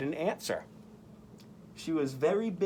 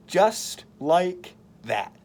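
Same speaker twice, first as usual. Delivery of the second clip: dramatic slow; 4 semitones higher, 6 dB louder, much slower than usual. Acted. Dramatic catch in story.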